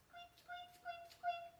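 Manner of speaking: tiny, very high-pitched squeaks; little mouse squeak. Pretend. Teacher saying hello like a mouse to children, squeaky. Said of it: "like a little mouse".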